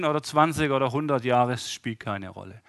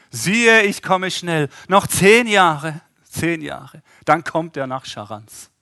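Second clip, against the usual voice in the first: mock ironic promise; 3 semitones higher, 9 dB louder, slower than usual. Mocking twisted promise.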